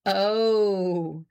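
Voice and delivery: long, drawn-out teasing "oh"; humorous. A narrator's reading. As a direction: stretch it out with playful banter. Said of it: "said Fred humorously".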